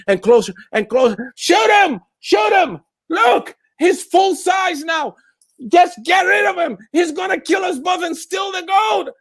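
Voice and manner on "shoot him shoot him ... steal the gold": desperate shout. Acted. Driver screams at the guard, near tears. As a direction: beg him to shoot, voice cracking.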